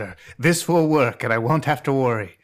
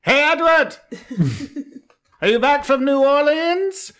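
loud, high mocking yell across the ballroom; shouting; 14 semitones higher, 4 dB louder, slower than usual. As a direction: shout it across the room, taunting. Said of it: "and he shouts".

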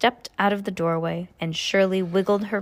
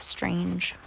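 soft, playful whispered echo; 6 dB quieter than usual. Pretend. Playful aside, repeated in whisper.

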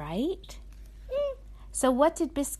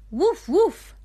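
high, loud playful woof; puppy barks; 8 semitones higher, 8 dB louder than usual. Acted. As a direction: yip like a little puppy. Mom voices the puppy.

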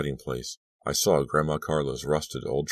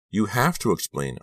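higher, insistent plea; cried out; 6 semitones higher than usual. A narrator's reading. Girl presses Lucy for an explanation, high insistent cry. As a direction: cry "have" out high, urging an answer. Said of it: "I cried".

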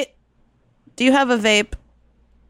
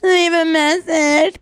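voicemail greeting voice; 6 semitones higher, slower than usual. Pretend.

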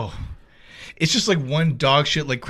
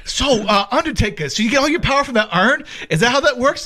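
talk show host voice; 8 semitones higher than usual. Retold or imitated. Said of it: "Regis is like".

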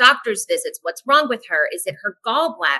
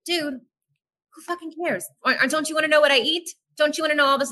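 higher, quick and exasperated; exasperated retort; 8 semitones higher, faster than usual. Retold or imitated. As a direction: throw the questions back, fed up. Exasperated at the doctor's questions.

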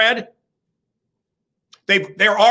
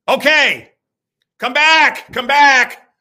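calling out; 6 semitones higher, 6 dB louder, much slower than usual. Real, not acted. Calling listener back.

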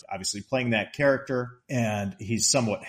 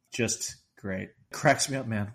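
normal talking voice

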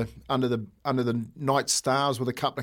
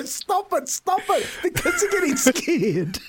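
high-pitched pleading through laughter; mock-alarmed teachers; slower than usual. Teachers begging him to stop scaring kids, laughing mock alarm. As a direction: imitate panicked teachers, breaking into laughter.

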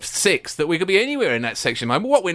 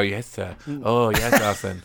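obedient disciple; 8 semitones lower, slower than usual. Acted. Mimicking a coach obeying his manager, meek and hushed. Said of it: "Keown's a Wenger disciple".

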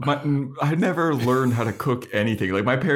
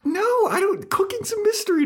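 exaggerated. Real, not acted. Mock helpless exaggerated admission.

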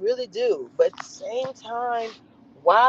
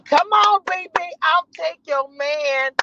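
higher, slow, sassy put-on voice; Jasmine voice; 8 semitones higher, 4 dB louder, slower than usual. Quoting the reality star, slow and sassy. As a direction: purr it like a catty rival. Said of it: "it would be being".

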